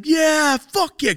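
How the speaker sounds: very high, loud cheering yell; concert scream. Retold or imitated. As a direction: cheer at the rapper. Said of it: "screaming the fucking lyrics".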